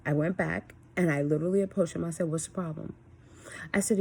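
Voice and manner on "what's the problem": softer retold calm voice; composed, calm question. Mom re-enacting approaching her son after yelling, calm. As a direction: ask it gently, temper reined in. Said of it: "I came to my senses".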